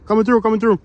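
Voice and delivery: loud high call ahead; coming through shout. Real, not acted. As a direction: shout a warning into the woods. Announcing himself to hidden campers.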